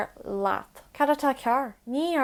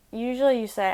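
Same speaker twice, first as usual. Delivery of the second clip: ordinary delivery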